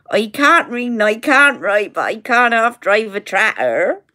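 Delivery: thick West Country Somerset burr; Somerset accent. Retold or imitated. Daughter performing for locals in the pub, Somerset accent. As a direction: do a broad Somerset farmer accent. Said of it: "how you do a Somerset".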